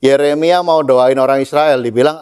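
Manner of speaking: ordinary talk, nothing marked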